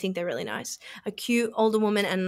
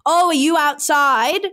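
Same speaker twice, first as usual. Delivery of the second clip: higher, put-on casual phone voice; raised, staged phone-call cheeriness; pretend phone chat; 5 semitones higher, 3 dB louder than usual. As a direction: fake that a friend is waiting outside. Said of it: "immediately changed tack and I was like".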